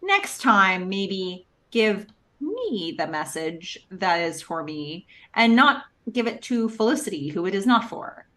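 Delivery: slow, pointed mock-polite request; passive aggressive. Ben asks about his message, hiding his thoughts, passive aggressive. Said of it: "very passive aggressive".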